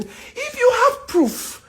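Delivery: high, slow, dragged taunt; indignant drawn-out dare; drawn-out challenge. Real, not acted. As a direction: dare them, stretching it out. Challenging the blog.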